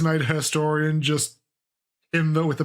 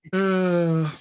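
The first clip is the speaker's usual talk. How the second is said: ordinary talk, nothing marked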